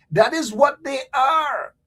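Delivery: slow shouted accusation; angry shout. Real, not acted. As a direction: hammer it home in anger. Heated political rant, shouting.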